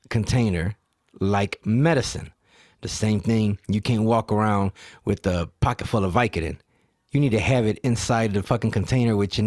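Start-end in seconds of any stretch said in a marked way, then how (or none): none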